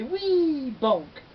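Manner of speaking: high, loud pronouncement; boy announcing the wrong spell; confident call. Acted. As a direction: call the word out proudly. Boy repeats the wrong word.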